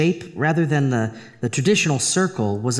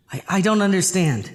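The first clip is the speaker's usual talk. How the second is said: shaken; 6 semitones higher than usual. Acted. Shaken reply to the voice.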